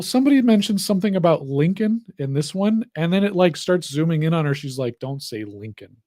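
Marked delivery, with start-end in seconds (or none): 5.0-6.0 low, soft, grave warning; mock-dramatic. Girl before her tragic backstory, mock-grave and hushed. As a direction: drop to a hushed, ominous plea. Imitating the girl's dramatic moment.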